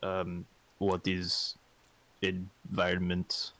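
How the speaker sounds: slow, odd-timbred character voice. Acted. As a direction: drag the question out, puzzled. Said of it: "We're all using character voices".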